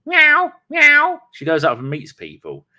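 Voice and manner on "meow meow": very high, loud meowing cat; high cat imitation. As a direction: meow like a friendly cat. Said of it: "always goes out there and goes".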